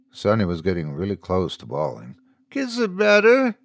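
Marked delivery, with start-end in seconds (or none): 2.5-3.6 put-on high, louder childlike whine; whiny little girl. A narrator's reading. Tearful daughter demanding a kiss, high whine. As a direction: demand it like a child about to cry.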